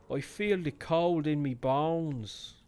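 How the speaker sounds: Irish accent. Shown as a demonstration. Streamer redoes the game line in an Irish accent. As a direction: lean into an Irish accent, slowly. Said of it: "I'm allowed to do that. I have Irish friends".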